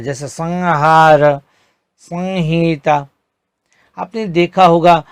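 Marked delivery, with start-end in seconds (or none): 0.3-3.1 संहार slow, bindu as अं before ह; slow pronunciation demo. Shown as a demonstration. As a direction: model संहार and संहिता slowly. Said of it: "उच्चारण अं की तरह होता है". (संहार में अनुस्वार को अं की तरह, धीरे)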